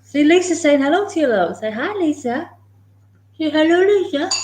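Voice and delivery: high sing-song voice to a dog; high-pitched doggy talk; pet talk. Real, not acted. Talking to the dog, prompting hello.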